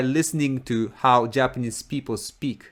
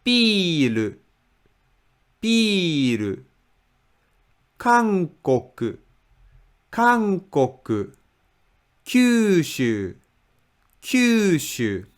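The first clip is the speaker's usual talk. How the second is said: "Biru", "Kankoku", "Kyushu" each high at first, gliding down; falling pitch; 3 semitones higher, slower than usual. Shown as a demonstration. Teacher shows first-mora stress, "Biru", "Kankoku", "Kyushu" falling. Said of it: "then pitch just falls".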